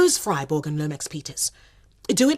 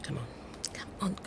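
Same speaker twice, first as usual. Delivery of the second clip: urgent whisper; 4 semitones lower, 17 dB quieter, faster than usual. Acted. Urging herself on, tense.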